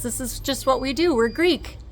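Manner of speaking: a hint of a proud Greek doctor; lightly voiced proud surgeon. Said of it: "He says".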